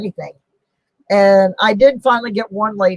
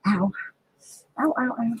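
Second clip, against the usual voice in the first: soft pained ows; 4 semitones higher, 7 dB quieter, faster than usual. Real, not acted. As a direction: wince through the pain quietly. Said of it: "while I grimace and grunt".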